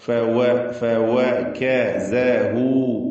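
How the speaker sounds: ഫവകസഹു very slowly, syllable by syllable; careful articulation. Shown as a demonstration. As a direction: separate each syllable of ഫവകസഹു. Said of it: "ഇവിടെയും അത് തന്നെ".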